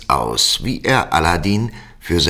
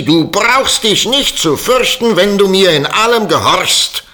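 high, loud commanding shout; 11 semitones higher, 6 dB louder than usual. Acted. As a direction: bark orders at the frightened boy. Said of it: "und schrie".

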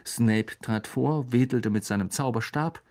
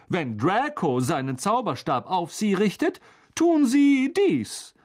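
showy demonstration; 7 semitones higher, 4 dB louder than usual. A narrator's reading. Teacher showing pupil a wand move, showy. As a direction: stretch the final words grandly.

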